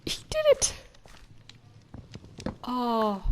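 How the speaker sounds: excited squeal. Real, not acted. Bee enters the hive.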